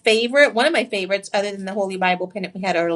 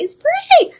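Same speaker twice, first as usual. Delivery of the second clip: high, loud squeal of delight; thrilled squeal at the ring; excited squeal; 7 dB louder than usual. Real, not acted. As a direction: squeal over how pretty it is. Gushing over ring.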